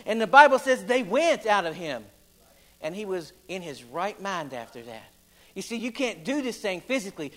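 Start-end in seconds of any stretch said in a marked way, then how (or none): 0.1-2.0 raised, rhythmic pulpit preaching; full-force revival preaching. Acted. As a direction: preach it out to the congregation. Preacher in full sermon delivery.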